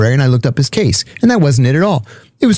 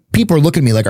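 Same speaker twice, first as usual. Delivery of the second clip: ordinary delivery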